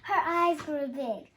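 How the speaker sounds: ordinary delivery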